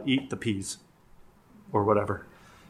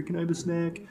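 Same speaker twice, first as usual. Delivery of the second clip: put-on child voice; 6 semitones higher, 4 dB quieter than usual. Child asking parent, voiced.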